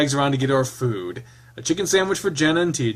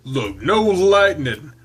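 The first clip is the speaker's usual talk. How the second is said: louder, slow taunt; 4 semitones higher, 5 dB louder, much slower than usual. Acted. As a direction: tease slowly and loudly. Taunting, said twice.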